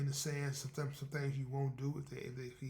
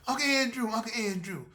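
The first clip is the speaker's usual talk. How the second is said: attempted young girl voice; higher girlish voice he can't quite get; 7 semitones higher, 8 dB louder than usual. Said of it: "I can't get the girl voice right".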